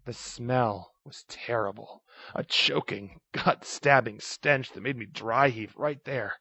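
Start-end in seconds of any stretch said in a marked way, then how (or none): none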